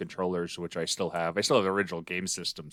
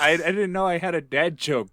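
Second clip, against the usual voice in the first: laughing; 8 semitones higher, 5 dB louder than usual. Real, not acted. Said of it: "You always have a dad joke tone".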